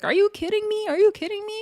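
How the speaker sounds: very high incredulous squeal; mock outrage. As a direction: imitate an indignant high-pitched protest. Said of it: "the way these girls are yelling".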